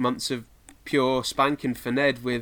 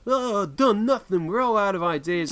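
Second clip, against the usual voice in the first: mock quote; 6 semitones higher than usual. Quoting the viral clip of clueless parents, higher and whiny. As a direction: lightly mimic the helpless parents. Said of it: "that clip of, like".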